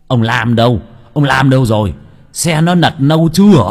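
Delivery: loud, urgent demanding questions; panicked shouting. Acted. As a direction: shake him and shout for answers. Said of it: "Ông trưởng thôn hoảng hốt".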